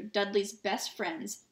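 ordinary delivery, nothing marked

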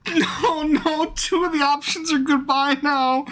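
high, helpless laughing wail; laughing despair. Real, not acted. Despairs at game's options.

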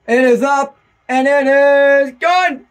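high, loud announcer building to the result; excited kick call. Real, not acted. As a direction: proclaim the good kick. Calling the kick after touchdown.

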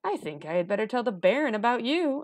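put-on high, loud indignant boy; huffy, pitch climbing as you threaten. A narrator's reading. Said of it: "prompted me to get indignant".

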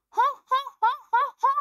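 high, loud gloating laugh; gloating cartoon baddie laugh; villain laugh.

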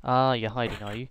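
ordinary talk, nothing marked

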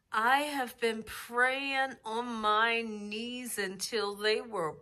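ordinary talk

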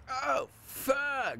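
very high-pitched mock scream; imagined cuckoo shout. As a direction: shriek it like a cuckoo bursting out. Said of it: "My first thought was just like".

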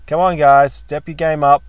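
loud, pushy call to step up. Real, not acted. Calling out gym-goers.

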